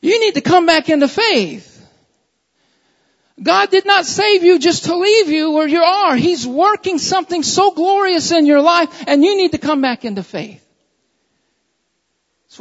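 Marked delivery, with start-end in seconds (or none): 0.0-10.6 loud, ringing preacher's call; full-force preaching. Acted. Pastor calling the church back to faith, loud preaching. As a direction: urge the congregation back to faith, loudly.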